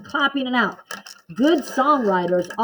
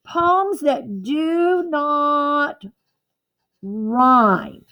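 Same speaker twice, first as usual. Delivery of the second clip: slowly, word by word for copying; slow teacher dictation voice; 3 semitones higher, much slower than usual. Shown as a demonstration. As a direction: spell it out slowly so they can write it down. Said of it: "Please write this on your paper".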